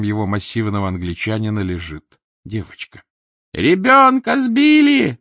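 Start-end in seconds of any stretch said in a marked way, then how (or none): 3.5-5.2 very high, loud, drawn-out scream; woman screaming. Acted. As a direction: shriek it to the whole yard. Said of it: "закричала женщина". (пронзительный женский крик)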